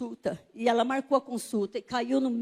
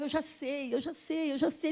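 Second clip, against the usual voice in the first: higher, softer repeated interjection; impatient insistence; 3 semitones higher, 6 dB quieter than usual. Retold or imitated. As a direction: keep cutting in insistently. Cutting off the doctor, retold.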